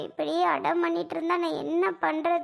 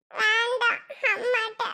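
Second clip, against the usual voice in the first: put-on small, whiny child voice; refusing child; 3 semitones higher than usual. Child refusing to sleep beside aunt, whining. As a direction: refuse in a little child's whine.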